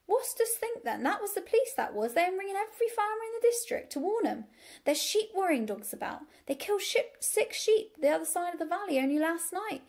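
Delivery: high, loud, gabbling country farm wife; farm wife calling. A narrator's reading. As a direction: rattle out urgent gossip at the top of your voice. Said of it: "called Missus Hoggett".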